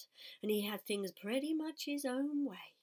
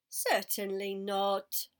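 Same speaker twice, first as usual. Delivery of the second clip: slow, louder haughty lamb; 8 dB louder, much slower than usual. Acted. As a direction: draw it out with disdain. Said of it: "said the lamb".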